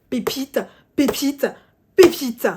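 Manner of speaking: loud, building hammer blows on one word; escalating, emphatic praise; emphatic exclamation. Real, not acted. Emphatic repeated verdict, hitting the manga.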